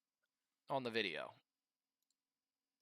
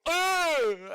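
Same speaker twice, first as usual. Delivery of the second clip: a sudden loud high grunt of fright; startled grunt; 14 semitones higher, 8 dB louder than usual. Retold or imitated. Mocking a jump-scare viewer, sudden loud high startled grunt. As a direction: jolt out a sharp scared grunt. And then randomly you go.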